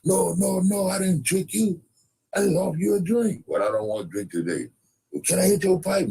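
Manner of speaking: high-pitched pleading settler; comic high-voiced settler; high put-on voice. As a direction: push the voice up high and wheedle.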